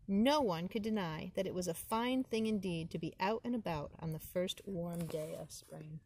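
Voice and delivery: ordinary delivery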